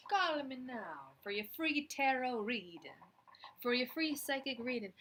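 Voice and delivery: mock Jamaican TV psychic ad read; late-night ad, Jamaican accent; Miss Cleo Jamaican accent. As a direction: sell the free reading in a Jamaican accent. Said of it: "very convincing Jamaican accent".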